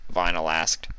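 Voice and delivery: ordinary delivery, nothing marked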